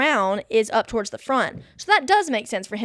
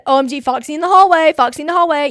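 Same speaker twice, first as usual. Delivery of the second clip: excited; 5 semitones higher, 7 dB louder, faster than usual. Retold or imitated. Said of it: "I said".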